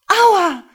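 loud high cry of pain; pained scream. Acted. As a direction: cry out from the cane stroke. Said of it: "Lena schrie laut auf". (Lena schreit vor Schmerz laut auf)

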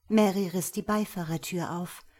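ordinary delivery, nothing marked

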